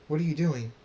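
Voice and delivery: high, urgent questioning; alarmed question. A narrator's reading. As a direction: cry out in alarm. Draconix alarmed by the magic.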